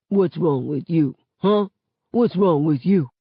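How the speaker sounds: mock deep voice. A narrator's reading. Father teasing his upset daughter into giggling, playful deep voice. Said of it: "in a real deep voice".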